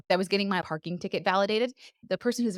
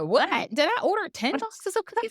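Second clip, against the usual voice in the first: much higher, mock disbelief at the price; retold shock; 9 semitones higher than usual. Retelling to her co-host her shock at the cookie bill, high mock disbelief. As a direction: squeal it like the bill just landed.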